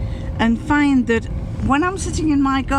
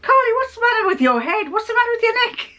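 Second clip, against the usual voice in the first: high, curious teacher voice; puzzled teacher; 13 semitones higher, faster than usual. Retold or imitated. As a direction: ask it nosily, a bit baffled. Teacher asks about scarf.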